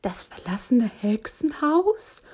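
startled gasp of a question, rising; frightened whisper. A narrator's reading. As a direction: voice a scared little character, drawn out. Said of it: "flüstert Hox erschrocken".

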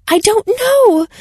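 high, slow, strained I don't know; lifted high, drawn-out despair; distressed. Acted. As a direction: near breaking under pressure. Anna cornered, distressed.

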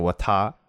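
"wata" with TA stressed and lengthened. Shown as a demonstration. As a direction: lean hard and long on TA in "wata". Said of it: "And then I stressed the ta".

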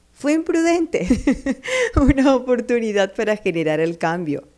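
laughing. Real, not acted. Laughs at own example.

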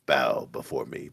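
deep, menacing evil god voice; megalomaniac rant. Pretend. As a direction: boom it out like an eldritch terror. Cthulhu chia pet speaking.

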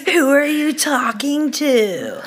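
mocking American accent, slow, laughing.

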